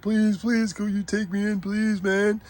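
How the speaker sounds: high, pleading little cat voice; begging cat voice. Retold or imitated. Stray cat begging the owner to take him in, high pleading voice. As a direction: beg to be let in like a stray cat. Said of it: "He actually said that".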